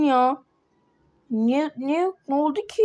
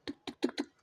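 soft voiceless tik tik tik, knuckles on a door; mouthed knocks on the school door; knocking sound; 15 dB quieter than usual. Acted. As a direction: tap it out under your breath like knocking. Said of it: "Vurayım kapıya".

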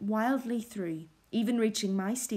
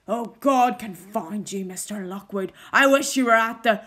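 startled rage breaking into a shout; angry curse; 9 dB louder than usual. A narrator's reading. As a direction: spit the curse at the guest. Startled host curses his guest.

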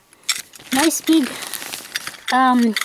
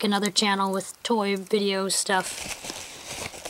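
normal talking voice

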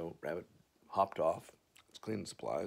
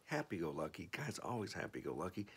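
ordinary delivery

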